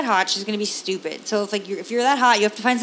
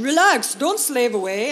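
put-on Jamaican accent; 3 semitones higher, slower than usual. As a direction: imitate a chill Jamaican crab telling her to relax. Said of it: "That's a good Jamaican accent".

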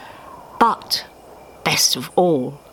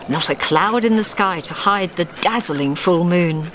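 usual speaking voice

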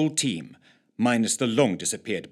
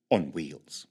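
ordinary delivery, nothing marked

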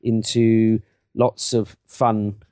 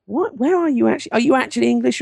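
high-pitched mock bewilderment; astonished fans; 10 semitones higher, faster than usual. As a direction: imitate baffled listeners, voice jumping up high. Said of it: "People are like".

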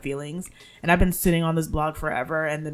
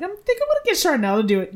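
excited aside; 13 semitones higher, 7 dB louder, faster than usual. Retold or imitated. Telling mom her writer plan.

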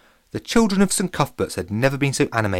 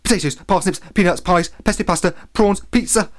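high-pitched list rattled off in one go; rapid reel-off; 8 semitones higher, 4 dB louder than usual. A narrator's reading. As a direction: reel them off breathlessly, climbing in pitch. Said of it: "rapidly reeled off".

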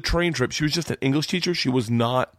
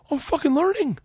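high, thrilled self-quote; bursting with pride at learning Russian; giddy excitement; 13 semitones higher than usual. Retold or imitated. Teen on a train thrilled he understands Russian. Excited thought, much higher.

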